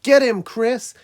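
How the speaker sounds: loud, high-pitched jeer from the crowd; jeering yell. A narrator's reading. Guards and workers cheering the ninja on, jeering yell. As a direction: yell it out like a spectator egging on a fighter. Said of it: "They were yelling and jeering things like".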